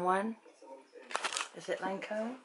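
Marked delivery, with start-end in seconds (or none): none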